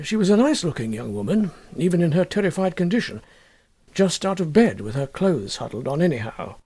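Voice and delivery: usual speaking voice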